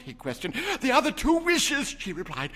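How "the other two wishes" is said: high, loud eager reply; excited. A narrator's reading. Wife telling her husband of the wishes, high and eager. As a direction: rush it out eagerly.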